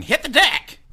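high mock yell; yelled quote. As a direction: read it as a shout. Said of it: "throwing firecrackers and yelling".

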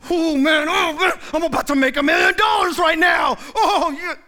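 loud, high boastful cheer; mock hyped excitement. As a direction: hype yourself up like you just got rich. Angry man imagines a windfall.

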